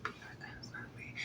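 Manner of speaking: hushed whisper urging her to fight; whispered egging on. Retold or imitated. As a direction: whisper it like a sideline hype crowd. Crowd egging Natalie on.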